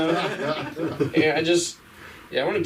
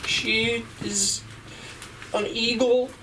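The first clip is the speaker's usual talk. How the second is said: high, slow, swooning devotion; high hammed-up lovesick plea; lovestruck lover boy; 9 semitones higher than usual. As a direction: defend her honor like a smitten poet. Lover boy defends woman.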